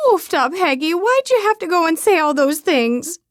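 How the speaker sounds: a weepy Midwestern aunt wailing at her sister; crying loudly. A narrator's reading. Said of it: "she cries loudly".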